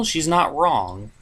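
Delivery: normal talking voice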